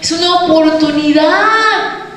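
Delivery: high, slow, stretched-out exhortation; impassioned preaching. Acted. Preacher urging women to speak of Christ, impassioned preaching. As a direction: proclaim it, drawing out each phrase.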